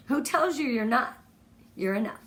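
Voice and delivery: usual speaking voice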